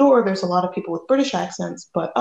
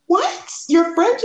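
high-pitched, louder mock disbelief; astonished; 10 semitones higher, 3 dB louder, slower than usual. Storyteller reliving learning her dance partner is French, astonished. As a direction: leap up in pitch, incredulous retelling. Retold shock that he's French.

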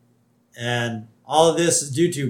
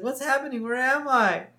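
high, small mock-confused voice; imitated bewildered Lisa; 10 semitones higher than usual. Girl caught in the lights, high imitated bewilderment. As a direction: pitch it up like a dazed little girl. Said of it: "and Lisa's like".

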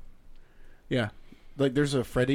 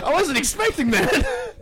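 laughing surprise; 11 semitones higher, 7 dB louder, slower than usual. Retold or imitated. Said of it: "And I was like".

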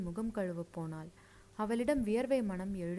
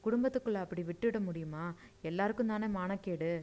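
ordinary delivery

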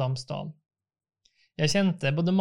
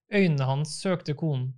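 ordinary delivery, nothing marked

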